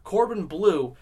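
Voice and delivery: ordinary talk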